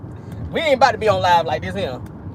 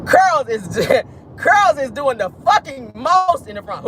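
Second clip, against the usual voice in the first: crying laughter; 4 semitones higher, slower than usual. Real, not acted.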